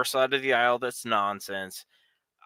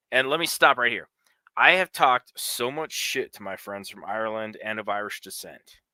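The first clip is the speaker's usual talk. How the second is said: normal talking voice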